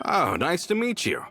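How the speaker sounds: high, eager hello; cheery greeting. Acted. Coulter meets the two newcomers, cheery and high. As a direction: greet them brightly. Meeting new friends.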